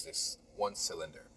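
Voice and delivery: ordinary talk, nothing marked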